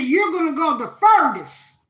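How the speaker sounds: a proud Southern grandma urging her grandbaby on; loud, high-pitched grandma blessing; grandma's voice. Retold or imitated. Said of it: "She said".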